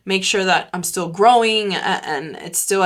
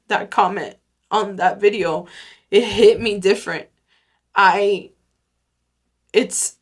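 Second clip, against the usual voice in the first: normal talking voice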